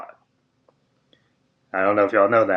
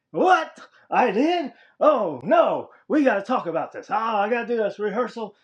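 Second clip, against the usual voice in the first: high mock flustered boss; Vince; 11 semitones higher than usual. The boss imitated realizing he fired Jimmy, high and flustered. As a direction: toss it off high, fake-shocked, then brush it aside. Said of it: "I was like".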